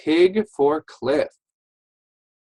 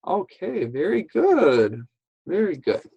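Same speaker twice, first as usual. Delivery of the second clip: higher, encouraging teacher voice; enthusiastic praise; 6 semitones higher than usual. Real, not acted. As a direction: cheer the pupils on with lifted pitch. Teacher praising pupils after their turn.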